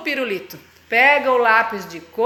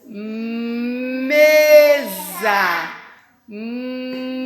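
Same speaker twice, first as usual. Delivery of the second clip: slow sounding out; 3 semitones lower, much slower than usual. Shown as a demonstration. Teacher modelling the word. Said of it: "Como é que eu falo mesa?".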